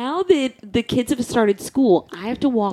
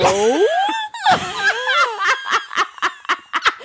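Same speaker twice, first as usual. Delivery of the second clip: high shrieking laugh. Real, not acted. Sister shrieking laugh at being recognized.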